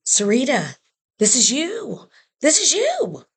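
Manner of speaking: high, insistent pep talk, acted out; emphatic urging, pitch climbing high; urgent encouragement. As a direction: imitate friends rallying someone with conviction. Prison family rallies round, urging her.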